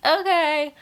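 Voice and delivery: a breezy high "okay" from her; high quick okay. Retold or imitated. Woman agreeing to marry him on the spot, high and breezy. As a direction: toss off a bright, high yes. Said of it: "and she goes".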